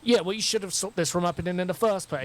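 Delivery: high-pitched, accusing comeback; indignant and high-pitched; heated retort. Acted. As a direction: argue back, throwing the blame. Acted quarrel, blaming back.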